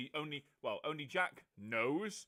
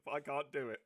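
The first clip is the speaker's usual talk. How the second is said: ordinary talk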